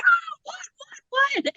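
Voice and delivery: very high, soft, panicky squeak; thin, high-pitched mock terror; retold panic. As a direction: imitate your own frightened whimpering. Retold panic in dark store.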